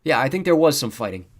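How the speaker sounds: loud, hedging correction; correcting. A narrator's reading. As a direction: correct your co-host on air. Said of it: "Tucker corrected her".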